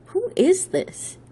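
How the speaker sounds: high, drawn-out baffled query; baffled question. A narrator's reading. Chauffeur asking an unknown caller to identify himself, baffled.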